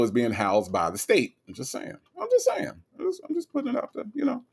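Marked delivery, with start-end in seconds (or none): 2.2-4.4 very high, softened voice; squeaky, mock-innocent aside; coy disclaimer. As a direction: back off innocently in a high little voice. Teasing disclaimer after blunt remark.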